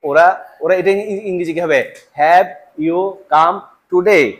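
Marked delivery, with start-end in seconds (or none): 2.2-4.2 slow careful English question; "Have you come today?" spoken slowly and plainly. Shown as a demonstration. Teacher giving the standard English before the Australian one. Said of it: "ওরা কেমনে বলে?".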